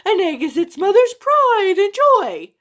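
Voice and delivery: shouting wife worm. Acted. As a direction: screech back at your husband to save the egg. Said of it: "the wife of the worm shouted back".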